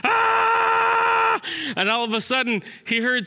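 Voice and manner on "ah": long, high, loud scream; angry, stressed scream. Acted. Squirrel more and more stressed, angry scream. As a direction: scream with mounting frustration. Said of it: "more and more angry and stressed".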